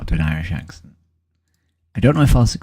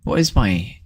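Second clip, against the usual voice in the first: usual speaking voice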